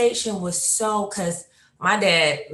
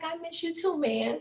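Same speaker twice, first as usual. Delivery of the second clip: much higher and softer, light and casual; tossed off sweetly, not knowing it's the last call; high soft girlish; 11 semitones higher, 7 dB quieter than usual. Retold or imitated. Daughter saying goodbye to her dad, high and soft.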